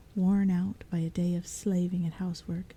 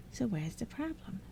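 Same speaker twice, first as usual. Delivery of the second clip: much softer, sighing goblin; impatient goblin sigh; 4 semitones higher, 7 dB quieter than usual. Acted. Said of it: "with an impatient sigh".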